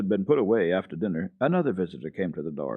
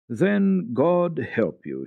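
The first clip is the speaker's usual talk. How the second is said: slow, sad sigh of a blessing; sad parting; 8 semitones higher, 6 dB louder, much slower than usual. A narrator's reading. Pastor leaving the stubborn old man, sad. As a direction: give up on him, sorrowful. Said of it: "went sadly out of the hut".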